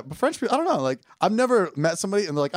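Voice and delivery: ordinary talk, nothing marked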